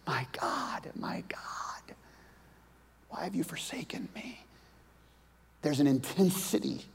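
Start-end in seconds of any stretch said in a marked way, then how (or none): 0.1-4.4 dying whisper. Retold or imitated. Jesus dying on the cross.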